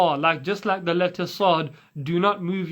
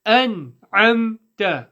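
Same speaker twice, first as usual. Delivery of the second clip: slow chant with long vowels; measured teaching chant; Quranic recitation; 4 semitones higher, 4 dB louder than usual. Shown as a demonstration. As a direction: recite each letter firmly and clearly.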